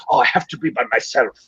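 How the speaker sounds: lightly put-on pretentious artist voice; a half-hearted, badly faked brooding artist; Javier Bardem. Host mocking the tortured writer, lightly pretentious. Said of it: "I'm doing a horrible Javier Bardem impression".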